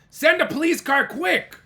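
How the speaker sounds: high, loud urgent order; urgent Michael. Acted. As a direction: hurry them along. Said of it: "said Michael".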